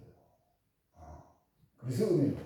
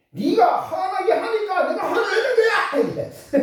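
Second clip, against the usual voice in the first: high, loud, slow mock tirade with a laugh in it; mock angry shout; 6 dB louder, slower than usual. Preacher mocking an angry person who blames others, shouting with a laugh. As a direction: play the hothead blaming the other, half laughing.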